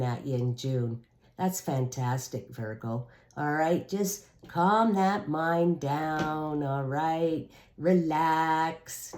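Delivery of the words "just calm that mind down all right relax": slow calming. Real, not acted. Soothing the anxious Virgo listener.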